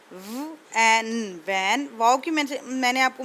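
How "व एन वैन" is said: very slow separate sounds व and एन, then blended into वैन; phonics sounding out. Shown as a demonstration. Teacher sounding out वैन for students, slow phonics. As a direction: stretch out व, एन before saying वैन. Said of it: "यहाँ पर देखिए वैन".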